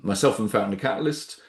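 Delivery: normal talking voice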